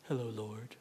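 lightly mock a serene painted Jesus; calm mock greeting. Preacher mocking the calm Jesus of paintings with a hushed hello. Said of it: "he's kinda like".

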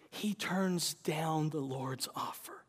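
slow hushed whisper. Real, not acted. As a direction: let it land slowly in a whisper. Whispered climax.

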